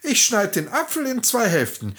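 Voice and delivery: fake-friendly farmer's wife; disguised peasant woman. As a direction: play along sweetly.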